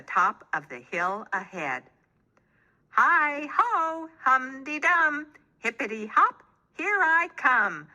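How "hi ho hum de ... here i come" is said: cheerful hippo hollering a rhyme. A narrator's reading. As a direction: holler it out up the hill. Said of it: "Hannah hollered".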